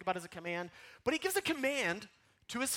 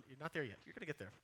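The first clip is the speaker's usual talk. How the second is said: normal talking voice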